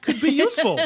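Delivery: laughing. Real, not acted. Joking about cousins, laughing.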